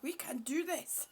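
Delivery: soft whispered encouragement; whispered pep talk. Retold or imitated. Urging her own eyes, a soft whispered pep talk. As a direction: coax it gently in a whisper. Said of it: "And I'm saying".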